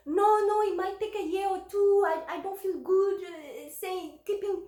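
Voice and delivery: high-pitched, hurried-sounding objection; acted protest, voice pitched well up; retold flustered refusal. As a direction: re-enact turning down a generous offer, flustered. Retold refusal of CEO's offer.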